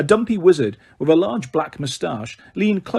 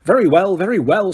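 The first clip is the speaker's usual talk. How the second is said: louder, irritated snap; snapped; 5 semitones higher, 4 dB louder than usual. A narrator's reading. Fudge giving in to Dumbledore, irritated snap. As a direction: bite the words off curtly. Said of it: "snapped Fudge".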